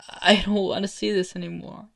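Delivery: high, loud desperate protest; distressed plea. Acted. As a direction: beg for it to stop. Boy begs for it to stop.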